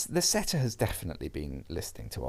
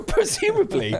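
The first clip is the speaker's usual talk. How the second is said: high, louder, through real laughter; laughing; 5 dB louder than usual. Real, not acted. Cracking up at co-host's joke, laughing through the word. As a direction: crack up while saying it.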